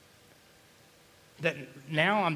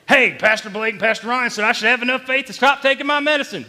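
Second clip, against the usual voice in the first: loud, higher-pitched imitation of an eager churchgoer; raised mock announcement; mock congregant; 7 semitones higher, 11 dB louder than usual. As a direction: mimic someone proudly announcing a wrong takeaway. Mimics congregant misreading the sermon.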